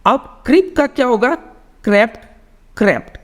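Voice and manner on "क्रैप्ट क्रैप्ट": teacher models क्रैप्ट for students, short vowel; short vowel sound. Shown as a demonstration. क्रैप्ट with the short e, not the long ee. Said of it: "short sound करो".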